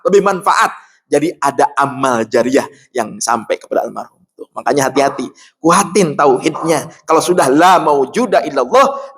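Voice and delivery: usual speaking voice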